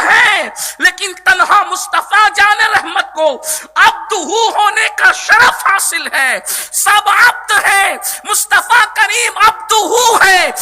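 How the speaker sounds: high, forceful sermon delivery; impassioned preaching. Acted. Preacher exalting the Prophet's servanthood to the congregation, impassioned. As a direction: drive each point home to the congregation.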